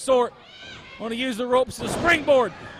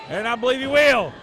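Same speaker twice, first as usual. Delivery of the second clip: usual speaking voice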